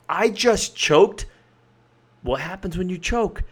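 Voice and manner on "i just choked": slow and drawn out, higher; emotionally charged. Shown as a demonstration. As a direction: let it sound like a disaster. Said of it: "The word choked carries a lot of emotion".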